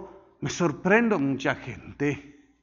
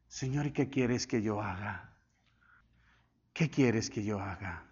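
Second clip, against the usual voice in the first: very low, soft plea to God; humble prayer; 8 semitones lower, 10 dB quieter than usual. Retold or imitated. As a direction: ask it quietly, in submission, as in private prayer. Retells his own surrendered prayer question. (súplica humilde, grave y muy suave)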